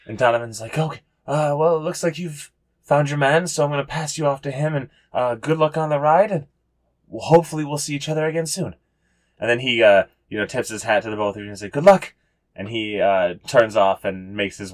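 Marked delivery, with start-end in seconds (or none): 0.8-8.8 higher-pitched in-character farewell; see the travelers off in a raised voice; friendly send-off character. Acted. 11.7-12.1 higher, louder acted send-off; hearty farewell. Acted farewell, calling to both.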